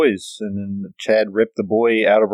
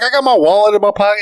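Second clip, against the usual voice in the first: high, loud, fast protest; mock suspect pleading; whiny suspect imitation; 12 semitones higher, 6 dB louder, faster than usual. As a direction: rattle it off like a nervous suspect. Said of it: "he's like".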